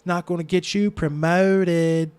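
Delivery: slow, loud, high Baltimore twang; hammed-up Baltimore accent, long fronted O; exaggerated Baltimore accent. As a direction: stretch "promoted" in a thick Baltimore accent. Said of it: "not to talk like that".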